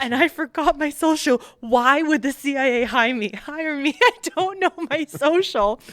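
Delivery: hammed-up wail, high and loud; mock bawling. Recruit reliving her flight home, mock bawling. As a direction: wail it in comic despair. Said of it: "I'm just sitting there bawling".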